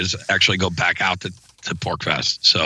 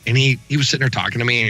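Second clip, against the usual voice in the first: normal talking voice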